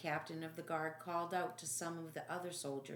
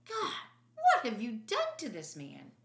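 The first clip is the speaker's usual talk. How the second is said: higher, louder horrified call; shocked outcry; 6 semitones higher, 8 dB louder than usual. Acted. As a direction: cry out at the sight. Barabbas shocked at wounds.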